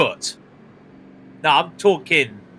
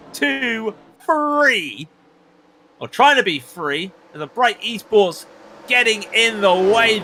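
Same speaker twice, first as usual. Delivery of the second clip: excited play-by-play; 8 semitones higher than usual. Acted. Commentator calling the restart to listeners, excited race call. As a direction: call the restart like it's live on air.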